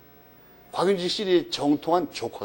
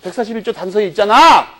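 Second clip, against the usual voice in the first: louder, punching "있잖아" with a fall; emphatic exclamation; 8 dB louder than usual. Shown as a demonstration. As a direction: drive the point home to the class. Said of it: "emphatic answer after the question".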